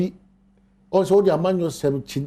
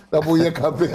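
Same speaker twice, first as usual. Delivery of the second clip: laughing. Real, not acted. Laughing during anecdote.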